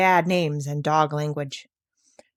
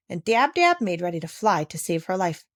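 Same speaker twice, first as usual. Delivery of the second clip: ordinary delivery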